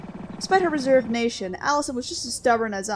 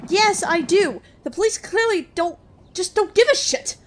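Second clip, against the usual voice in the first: frustrated; 7 semitones higher than usual. Acted. Voicing frustrated character.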